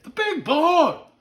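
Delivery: put-on high, loud, drawn-out child voice; awestruck little boy. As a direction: imitate a kid marveling, stretching each word. Retold child repeats favorite moose.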